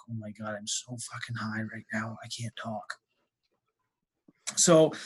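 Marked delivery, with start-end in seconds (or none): 0.1-2.9 much softer, lower aside; hushed private confession; inner thought. Retold or imitated. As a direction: mutter it privately to yourself. Inner thought while too high.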